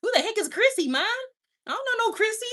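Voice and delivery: put-on high, loud confused kid voice; hammed-up baffled son; imitating her son. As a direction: play the son who has never heard of Chrissy. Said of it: "He's like".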